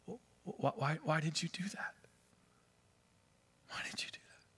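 faint breathless whisper, fading away; the drowning girl whispering to the snake; dying whisper. A narrator's reading. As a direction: gasp it out with the last breath. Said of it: "with her last breath".

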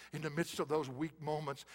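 ordinary delivery

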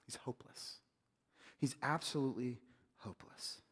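whispered, almost breathless hopeless; hushed despair. Real, not acted. Preacher to congregation on the servant's plight, a soft whisper. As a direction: let it fall to a whisper.

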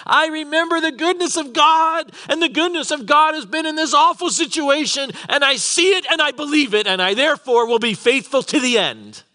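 high-pitched, full-voiced declaration of faith; impassioned preaching. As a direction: proclaim it fervently at a high pitch. Preacher voices Joseph's impassioned vow.

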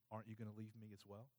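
low, very soft, quick question; subdued, intimate asking; hushed question. Retold or imitated. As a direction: ask it quietly, almost under your breath. Jesus gently asks remaining disciples.

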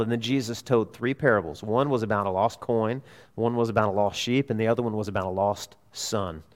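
usual speaking voice

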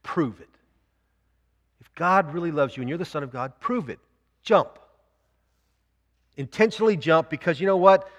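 jab the words at him, daring; a devil's pointed challenge; Satan's dare. Retold or imitated. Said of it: "He points right in the chest and says".

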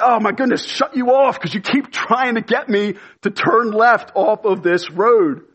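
high-pitched, hammed-up exasperation; exasperated. Driver fed up with the navigation voice, acted exasperation.

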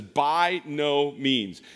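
each word slow and weighty, BY falling; slow, heavy "By no means"; slow emphatic. Shown as a demonstration. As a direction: lean on "By no means" slowly. Repeats the verse, slower.

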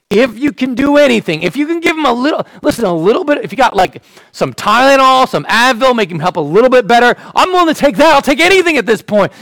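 begging for any help at all; desperate father. Acted. Father begging Jesus to heal his son, desperate. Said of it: "this man's sort of desperation".

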